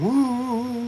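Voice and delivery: high, drawn-out playful woo; sung "woo". Pretend. Host quoting the lime and coconut song, playful woo. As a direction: let out a long, high "woo".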